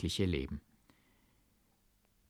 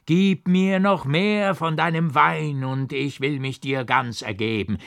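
put-on drunken yelling; 5 semitones higher, 5 dB louder than usual. Monk in the tower bawls for more wine, drunken yell. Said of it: "geschrien habe".

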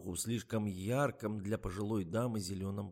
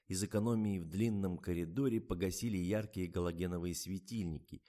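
usual speaking voice

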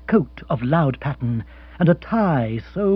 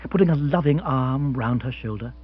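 plain reading voice

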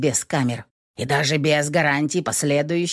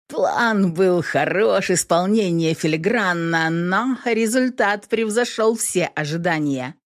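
ordinary delivery, nothing marked

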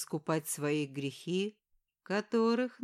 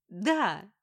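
sharp shouted yes in his face; 5 dB louder than usual. Acted. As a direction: fling the word back as a shout. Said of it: "выкрикнула она мне в лицо".

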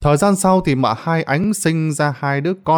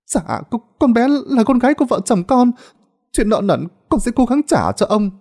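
fearful mother; 9 semitones higher than usual. A narrator's reading. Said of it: "bà sợ ông có ý xấu".

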